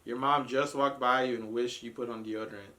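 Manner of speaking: usual speaking voice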